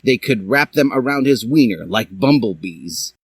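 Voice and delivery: usual speaking voice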